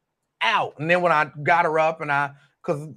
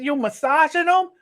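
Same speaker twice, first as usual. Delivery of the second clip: put-on much higher little-girl voice, puzzled question; imitating a four-year-old girl; 13 semitones higher, 5 dB louder than usual. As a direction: squeak it out like his small granddaughter. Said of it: "And she said".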